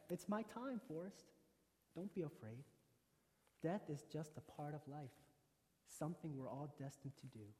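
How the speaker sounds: lightly voiced gentle mother, soft; Forrest's mother. Dying mother comforts her son, lightly voiced and soft. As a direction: quietly reassure your son, voice softening and dropping. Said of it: "And she just says".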